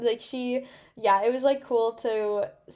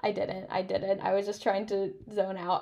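ordinary talk, nothing marked

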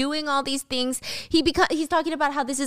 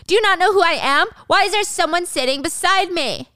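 high, loud, indignant outrage; furious rant; 9 semitones higher, 4 dB louder than usual. Acted. Wang furious at the flight attendant, ranting loudly. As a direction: rage at being ignored.

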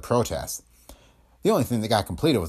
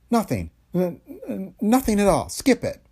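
higher, stammering denial; flustered and cornered; nervous stammer; 11 semitones higher than usual. Acted.

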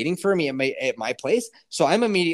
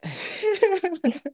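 high-pitched burst of laughter; real laugh; 13 semitones higher than usual. Real, not acted. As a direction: crack up high. Laughs at mace suggestion.

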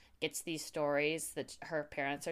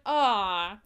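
louder mocking aww; mock pity; 3 semitones higher, 8 dB louder than usual. Mocking a loser with a loud fake-sympathy aww. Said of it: "It's like".

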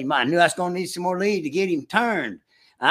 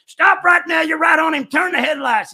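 retold urgent shout, much higher and louder; urgent yell; 14 semitones higher, 7 dB louder than usual. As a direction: bark the stop order like it's happening now.

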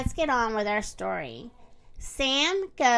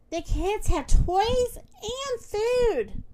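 high, slow, drawn-out wonder; awed delight; 9 semitones higher, much slower than usual. A narrator's reading. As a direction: marvel at each treat, stretching it out. Puppy longs for toys and food.

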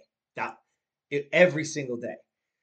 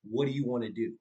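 a lower, steady question from mom; serious question; 5 semitones lower, 5 dB quieter than usual. Retold or imitated. Mom stopping the car to ask her son, low serious question. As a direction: mom asks calmly and earnestly. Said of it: "looked me dead in the face and she said".

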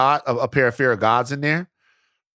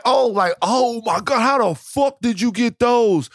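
excited fan shout; 9 semitones higher than usual. Retold or imitated. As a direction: gush like a starstruck stranger. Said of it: "how people acted".

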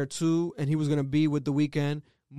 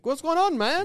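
mock Canadian; 11 semitones higher than usual. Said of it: "the holy trinity of Canadians".

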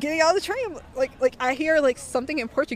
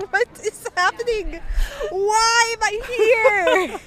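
panicked wail; 9 semitones higher, slower than usual. Retold or imitated. On a speeding bus at night, crying out in panic. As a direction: wail it out like you can't believe where you are.

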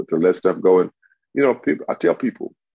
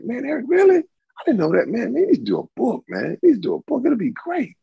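high, eager voice of friends urging him on; excited friends. Retold or imitated. Friends urging Eric to write a book, high and excited. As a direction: pitch it way up like amazed buddies.

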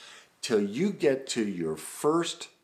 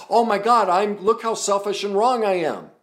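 put-on child's self-blame; 12 semitones higher, 11 dB louder than usual.

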